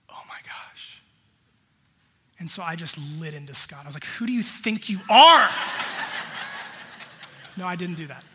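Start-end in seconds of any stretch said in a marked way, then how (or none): 0.1-1.0 whispered, dawning horror; a husband realizes he brushed off his wife; horrified whisper. Retold or imitated. 4.0-5.5 loud, hammed-up indignant snap; a joke about lashing out at the friend who corrected him; mock outburst. Mock outburst, then denies it. 5.5-7.5 breathy laugh. Real, not acted. Laughs after joke.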